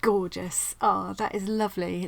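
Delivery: normal talking voice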